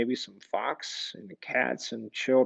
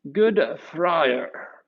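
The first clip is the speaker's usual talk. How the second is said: higher, loud, condescending address; haughty Contessa; 5 semitones higher, 9 dB louder than usual. Acted. Contessa interrupting Francis, loud and haughty. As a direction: talk down to the friar.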